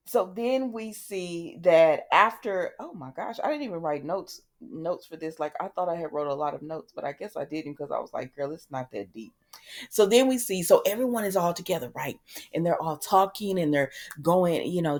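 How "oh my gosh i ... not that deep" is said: much softer, dropping away; self-chiding aside. Real, not acted. Host realizing she wrote no notes, quiet aside. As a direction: grumble quietly to yourself.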